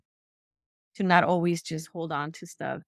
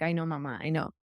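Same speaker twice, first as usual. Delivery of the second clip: put-on quietly conceding to her child; softer, tired voice; soft, weary admission; 4 semitones lower, 8 dB quieter than usual. Her soft reply to daughter.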